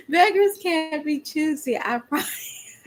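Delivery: laughing through the words, high and giddy. Real, not acted. Laughing to viewers about wanting thunder. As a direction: crack up while you say it. Laughing at her own wish.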